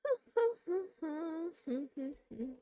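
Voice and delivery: breathy amused laugh. Real, not acted. Laughing at chat.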